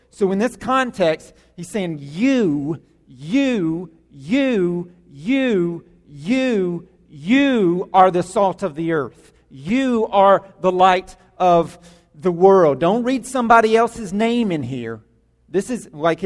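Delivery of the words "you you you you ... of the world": "You" jabbed at each listener, then slowed down; repeated you. Shown as a demonstration. Pastor pointing at each listener, stressing "you" slowly. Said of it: "It's emphatic and it's plural".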